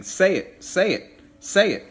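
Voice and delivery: high, loud demanding say it; mock insistent command. Shown as a demonstration. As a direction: bark the order to say it. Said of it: "if you're saying".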